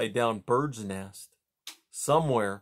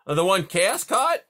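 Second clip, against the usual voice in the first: higher, louder gruff beaver voice; a sleepy beaver calling across the pond; 8 semitones higher, 6 dB louder than usual. Acted. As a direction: beaver asking who's there, pitched up. Beaver dialogue.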